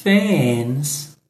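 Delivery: drawn out slowly for repeating; very slow model. Shown as a demonstration. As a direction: say it very slowly so they can repeat. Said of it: "vamos começar repetindo". (bem devagar, para repetir)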